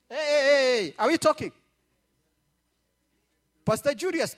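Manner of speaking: ordinary talk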